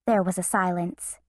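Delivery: ordinary delivery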